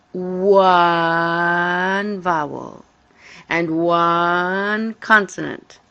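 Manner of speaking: "one" stretched out long and loud; emphatic ONE. Real, not acted. Teacher contrasting one vowel with two, emphatically. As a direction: hold each "one" long, then say the noun.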